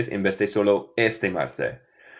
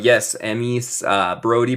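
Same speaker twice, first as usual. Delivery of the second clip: ordinary talk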